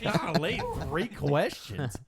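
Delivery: hammed-up golly reaction; mock amazement. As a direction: exclaim it slowly through a laugh. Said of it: "I was like".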